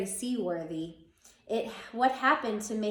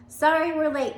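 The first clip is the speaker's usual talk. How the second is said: higher, louder cheerful hello; bright teacher greeting; 6 semitones higher, 7 dB louder than usual. A narrator's reading. Teacher greeting the librarian, higher and louder. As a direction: greet him up and out loud.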